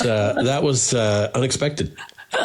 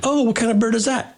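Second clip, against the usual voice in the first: mock wonder; 10 semitones higher than usual. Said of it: "And they'll say".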